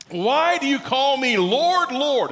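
emphatic, drawn-out "Lord, Lord"; preacher's slow, weighty "Lord, Lord"; stress on Lord, Lord. Shown as a demonstration. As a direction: lean hard on both words of "Lord, Lord". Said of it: "And the emphasis".

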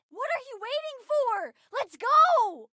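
high, impatient call to action; excited high-pitched cry; eager urging. Acted.

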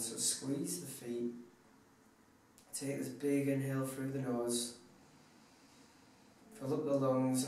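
hushed, unhurried guide into deep relaxation; guided meditation. Acted. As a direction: talk them softly through a body scan, letting each phrase settle. Leads relaxation body scan.

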